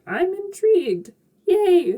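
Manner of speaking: high-pitched playful cheer; perky, hammed-up delight; mock enthusiasm. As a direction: pretend to be thrilled, pitch jumping up. Mock enthusiastic reader reaction.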